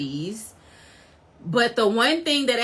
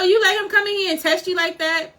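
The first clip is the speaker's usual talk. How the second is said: higher-pitched mock outrage; retold indignation; 8 semitones higher than usual. Fan acting out Luffy mad at Shanks, indignant. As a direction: challenge him as if mildly offended. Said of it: "Luffy got mad".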